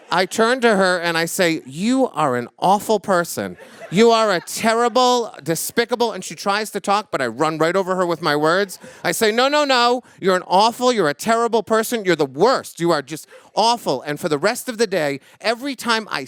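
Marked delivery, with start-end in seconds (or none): 1.7-6.1 high, loud telling-off that keeps climbing; scolding the rude woman at the soda fountain, loud and high; angry tirade. Retold or imitated. Berating the rude customer. 9.3-14.0 high, loud telling-off that keeps climbing; scolding the rude woman at the soda fountain, loud and high; angry tirade. Retold or imitated. Talking over the customer.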